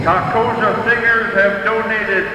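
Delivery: slow, measured arena announcer; powwow emcee. Real, not acted. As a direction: call out names to the crowd.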